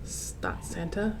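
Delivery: ordinary delivery, nothing marked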